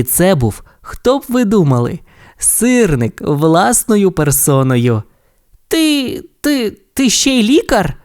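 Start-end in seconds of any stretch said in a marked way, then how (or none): 5.7-8.0 very high, stunned stammer; amazed stammer. Acted. Race car stunned the tow truck is a doctor. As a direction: stammer in disbelief. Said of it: "здивувався Блискавка".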